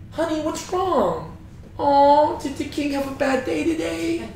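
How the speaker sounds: put-on high, soft baby-talk coddling; doting mother. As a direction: coo it like mom to a pouting child. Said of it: "It's like she's his mother".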